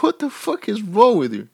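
high-pitched incredulous outburst; appalled disbelief. Retold or imitated. Retold outraged reply to slurs.

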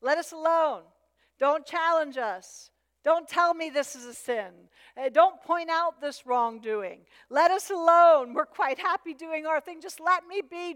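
high, loud protest; Satan's cry. Acted. Satan objecting to being challenged over sin, high indignant protest. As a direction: plead indignantly to be left alone. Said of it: "the cry of Satan in our life".

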